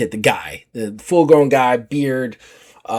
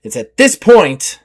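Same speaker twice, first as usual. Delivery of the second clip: normal talking voice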